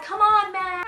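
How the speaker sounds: exasperated. Retold or imitated. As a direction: plead in frustration. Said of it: "and I'm just like".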